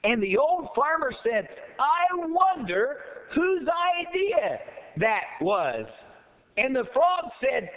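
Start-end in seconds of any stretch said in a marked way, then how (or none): 1.8-5.9 wondering aloud. Acted. Farmer marvelling at the flying frog, wondering aloud. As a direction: draw it out with hammed-up wonder.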